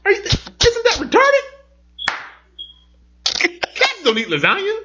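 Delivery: put-on high, slow, indignant kid voice; little kid voice. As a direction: play the outraged small boy, drawn out. Dad imitating son's cartoon verdict.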